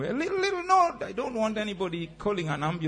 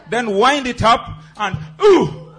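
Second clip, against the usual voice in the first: excited; 8 semitones higher, 10 dB louder, slower than usual. Shown as a demonstration. Fitness instructor hypes the class.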